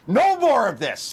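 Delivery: 1 angry insistence. Real, not acted. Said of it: "He's pissed".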